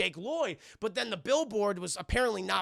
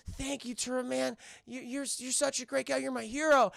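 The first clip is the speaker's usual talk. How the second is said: higher, mock little-boy voice; Anakin; 5 semitones higher than usual. Boy thanks Turbo Man, mock childlike adoration. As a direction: gush like an admiring child. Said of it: "little Anakin, he's talking".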